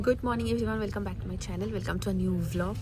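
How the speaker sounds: hushed vlog intro on a bus; speaking softly. Real, not acted. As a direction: keep it low so others aren't disturbed. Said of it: "খুব আস্তে আস্তে বলছি".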